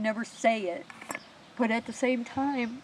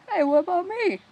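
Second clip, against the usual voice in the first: playful call; 8 semitones higher, 7 dB louder than usual. Retold or imitated. Dad calling out for a hug.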